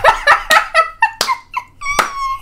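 very high relieved cackling; laughing fit. Real, not acted. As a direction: laugh helplessly, high and breathless. Laughing after scare.

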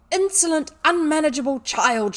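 loud, high-pitched rage; infuriated cry. A narrator's reading. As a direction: explode at the insolent pupil. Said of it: "she cried".

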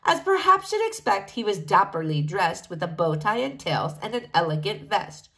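ordinary delivery

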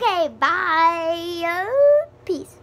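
high, loud, drawn-out farewell; cheerful goodbye. Real, not acted. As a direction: sing out a goodbye. Signing off.

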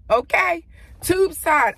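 loud, high teasing call; excited shout. Real, not acted. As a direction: call it out with a grin.

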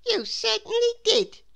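put-on high boy's voice; boy answering his friend; Jack's voice. A narrator's reading. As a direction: agree eagerly like a small boy. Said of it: "said Jack".

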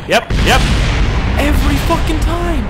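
high, loud outburst of frustration; loud, high-pitched exasperated cry; exasperated shout. Real, not acted. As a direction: yell it in real exasperation. Failed flight, exasperated outburst.

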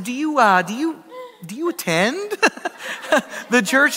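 acted-out sheepish stammer; nervous hesitation. Embarrassed pastor asks if she attends.

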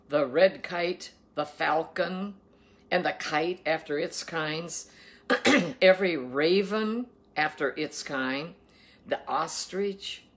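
slow, emphatic Bible reading; scripture reading. Acted. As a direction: proclaim the food laws, weighting each word. Reads scripture aloud.

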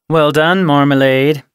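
cutting, loud sarcastic congratulation; withering sarcasm. A narrator's reading. Red mocks Marmalade for catching them, withering.

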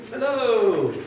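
high-pitched storybook holler; shouted greeting. A narrator's reading. Tortoise's shout repeated for the children, called out. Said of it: "shouted a tortoise".

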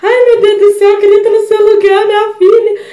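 loud, high imitation of a wailing mom; hammed-up tearful pleading to God; retold panic, high and loud; mock desperate mother. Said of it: "minha mãe lá chorando, em lágrimas, desesperada".

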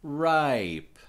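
RIPE drawn out, high then falling; going slow. Shown as a demonstration. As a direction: stretch the I in RIPE for learners. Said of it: "I'm going slow".

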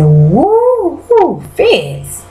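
high, hooting fan reaction; excited woo. Real, not acted. To the viewers, hyped up over Fitz. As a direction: cheer him on like a fan. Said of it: "Y'all, I said".

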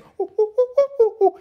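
loud, high mischievous cackle; high monkey laugh. Acted.

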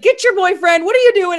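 high, loud frantic yell; retold panicked shouting for help; freaking out. Yelling at friend's boyfriend on the rink, freaking out. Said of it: "I started freaking out".

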